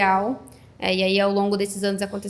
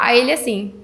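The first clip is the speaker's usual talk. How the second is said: ordinary delivery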